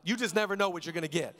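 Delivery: ordinary talk, nothing marked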